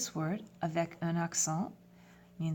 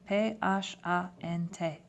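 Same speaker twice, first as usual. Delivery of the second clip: ordinary delivery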